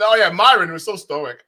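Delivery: mocking quote. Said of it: "this whole bullshit".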